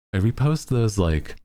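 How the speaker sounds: usual speaking voice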